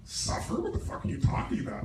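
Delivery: breathless, scoffing dismissal; scoffing disbelief. Retold or imitated. His old self scoffing at the idea of suffering, mock dismissive. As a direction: brush the question off with a scoff. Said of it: "I would have laughed at you".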